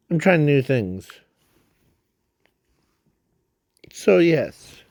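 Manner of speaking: breathing hard while talking; audibly heavy breaths between words. Real, not acted. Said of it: "Sorry if I'm breathing really hard".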